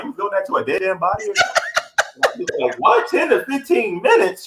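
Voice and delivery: incredulous and cracking up; mock horror through laughter; laughing disbelief.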